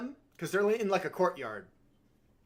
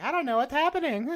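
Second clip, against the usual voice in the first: higher, lightly voiced lost old woman; bewildered old hag; 10 semitones higher, faster than usual. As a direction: play her confused and out of place. Voicing the dressed-up old hag.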